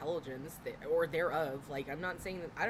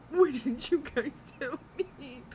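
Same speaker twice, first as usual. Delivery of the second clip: laughing; 8 semitones higher, 8 dB louder, slower than usual. Real, not acted. Laughing at her own joke.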